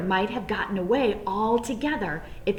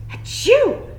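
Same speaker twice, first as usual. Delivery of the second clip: high, loud sneeze; a sharp achoo; 14 semitones higher, 8 dB louder than usual. A narrator's reading. Bunny hiding in the shed, sudden loud sneeze. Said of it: "Peter sneezed".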